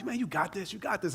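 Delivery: very soft, private pep talk; psyching himself up. Retold or imitated. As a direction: murmur encouragement to yourself. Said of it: "trying to use all the things that I know to psych myself up".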